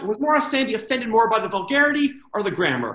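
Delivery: normal talking voice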